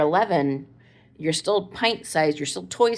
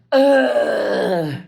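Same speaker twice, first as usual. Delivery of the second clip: loud exasperated "ugh" groan, pitched up; frustrated teen groan; 5 semitones higher, 8 dB louder than usual. Retold or imitated. Mom imitating her son's fed-up groan at the door. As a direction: let out a big fed-up teenager groan.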